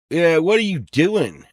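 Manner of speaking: high, loud mock yell of the guy; retold shout. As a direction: bark it like an angry stranger. Retold alley confrontation, guy shouting.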